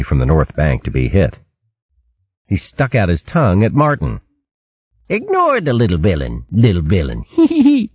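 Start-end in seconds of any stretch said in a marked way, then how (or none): 5.1-7.9 higher, sneering copy with giggle; jeering copycat snicker; mocking mimicry. A narrator's reading. Rat parrots Martin again.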